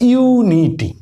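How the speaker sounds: ইউনিটি starting with a full ইউ; ইউনিটি demo; long u as ইউ. Shown as a demonstration. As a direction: say ইউনিটি with ইউ like the letter name. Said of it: "ইউ সরাসরি ইউ এর মতোই".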